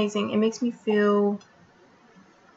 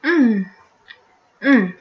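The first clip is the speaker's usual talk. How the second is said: chewing with closed lips; humming while chewing; mouth full of pizza; 4 dB louder than usual. Real, not acted. Seen 0: Bites pizza just before, closed mouth chewing during the hums.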